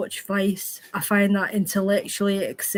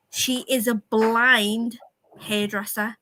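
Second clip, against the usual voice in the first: ordinary talk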